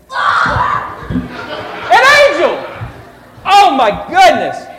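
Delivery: high, loud slow cry of alarm; mock fright. Storyteller hamming up shepherds' shock at the angel. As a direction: ham up the shock.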